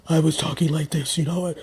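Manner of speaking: low rasp from a sore throat; hoarse low voice. Real, not acted. Vlogger showing her hoarse low cold voice. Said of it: "I was talking like a dude".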